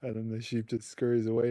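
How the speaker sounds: ordinary talk, nothing marked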